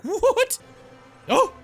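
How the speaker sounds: high, loud mock surprise; startled reaction. Player startled by the refrigerator cutting on, high startled cry. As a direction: squawk it out, voice leaping up. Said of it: "I was like".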